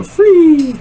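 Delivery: very high squeak; squeaky voice. Pretend. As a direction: squeak the word out. Squeaked punchline of the ad.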